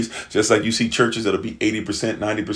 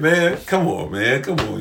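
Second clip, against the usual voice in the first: amused Daniel; 4 semitones higher than usual. Acted. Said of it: "Daniel starts laughing".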